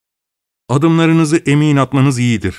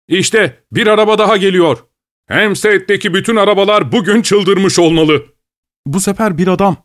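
higher-pitched, excited running commentary; excited onlooker; 6 semitones higher than usual. Acted. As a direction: call out the passing chase with excitement. Cab drivers commenting on the chase.

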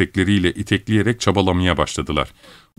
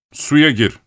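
higher-pitched curt order; master's raised command; 7 semitones higher, 4 dB louder than usual. A narrator's reading. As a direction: order a servant firmly. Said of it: "diye buyurdu".

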